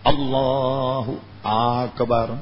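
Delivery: اللہ اکبر said very slowly with a clear hamza; correct takbir. Shown as a demonstration. Teacher demonstrating the correct takbir, very slow. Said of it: "یہ حمزہ تو صحیح نکالا جائے".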